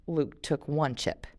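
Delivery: plain reading voice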